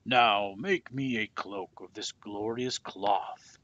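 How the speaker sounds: loud, slow royal command; booming king's command; commanding order. A narrator's reading. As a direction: order it like a demanding king. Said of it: "he ordered".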